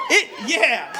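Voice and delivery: usual speaking voice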